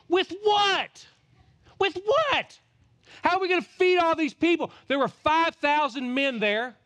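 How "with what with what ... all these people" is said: high, loud, incredulous cry; emphatic outcry. Acted. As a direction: throw the question back in disbelief. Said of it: "This is an emphatic statement".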